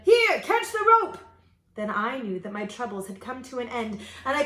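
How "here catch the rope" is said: high, loud shout from above; full storybook shout. Acted. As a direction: call down urgently. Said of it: "someone up high shouted".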